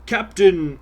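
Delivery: dramatic narrator voice. Retold or imitated. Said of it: "basically something like".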